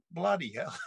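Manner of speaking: high-pitched imitation of a stunned friend; mildly hammed-up shock; retold amazement. Friend's shock, acted in retelling.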